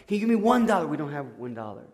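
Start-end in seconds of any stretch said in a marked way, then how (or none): 0.1-0.9 pleading. Retold or imitated. Man begs again, louder.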